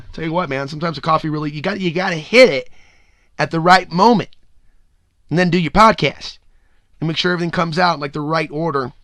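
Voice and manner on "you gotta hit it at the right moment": usual speaking voice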